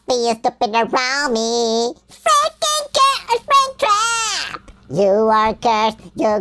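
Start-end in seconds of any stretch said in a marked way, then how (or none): 2.1-4.6 high, slow yell of his name; mock yell. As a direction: shout it up high and drawn out. Yelling at Springtrap in game.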